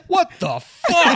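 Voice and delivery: high slow stunned laugh; shocked. Retold or imitated. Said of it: "he goes".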